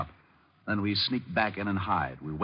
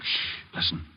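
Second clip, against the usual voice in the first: sharp whispered hush; urgent whispered shush; 6 semitones lower, slower than usual. Acted. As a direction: hush them without a sound. Hiding, hushing the others.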